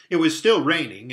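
ordinary delivery, nothing marked

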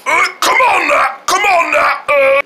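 high, groaning effort; straining to lift. Pretend. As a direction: push the words out while heaving. Man straining at the weight.

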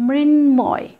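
মৃন্ময় as mrin-moy, drawn out with a full m; ম sounded. Shown as a demonstration. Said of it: "মকে পেয়েছি". (মৃন্ময়-এ স্পষ্ট ম উচ্চারণ)

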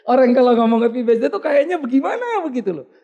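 bicara geli dengan suara lebih tinggi; sambil tertawa. Real, not acted. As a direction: ucapkan di sela tawa, keras. Tertawa geli membahas ucapan ulang tahun. (sambil tertawa geli)

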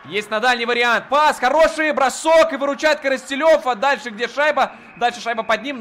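loud, high shout at the chance; excited save call. Real, not acted. (громкий взволнованный крик у ворот)

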